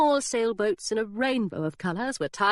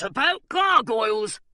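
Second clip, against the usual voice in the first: gargoyle voice; 3 semitones higher, 4 dB louder than usual. A narrator's reading. Gargoyle pipes up.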